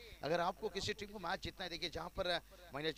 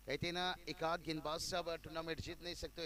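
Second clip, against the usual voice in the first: ordinary talk, nothing marked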